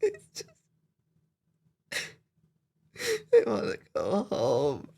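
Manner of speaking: choked, crying through the words. Real, not acted. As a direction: voice breaking into sobs. Crying audibly.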